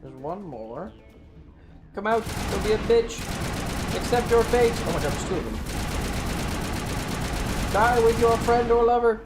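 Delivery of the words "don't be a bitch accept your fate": normal talking voice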